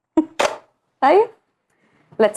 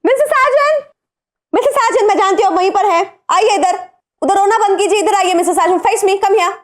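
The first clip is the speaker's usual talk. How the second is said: teasing call; 7 semitones higher than usual. Acted. Boss calling crying Sajan out of hiding, high teasing coax. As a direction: call him out like a sulking child.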